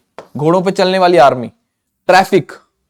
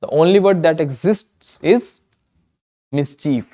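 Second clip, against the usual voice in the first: slow emphatic; 3 dB quieter, much slower than usual. Shown as a demonstration. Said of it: "mischief नाम का कोई word exist नहीं करता".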